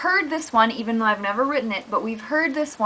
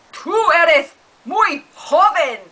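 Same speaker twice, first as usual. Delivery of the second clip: louder, slow taunt; mock taunt; 6 semitones higher, 6 dB louder, slower than usual. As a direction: jeer like a cartoon animal. Cartoon fight continues.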